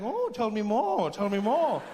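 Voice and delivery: slow, drawn-out mock fascination; sarcastic interest. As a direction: lead them on with ironic curiosity. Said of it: "And they're like".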